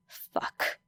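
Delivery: breathless whispered fuck; a whispered curse as the crowd roars. Acted. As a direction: swear under your breath. Muttered curse under breath.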